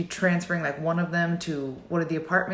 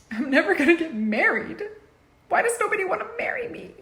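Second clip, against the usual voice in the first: whiny wail; 12 semitones higher, faster than usual. Pretend. Mock self-pity about marriage.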